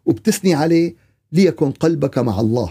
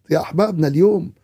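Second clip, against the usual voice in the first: ordinary delivery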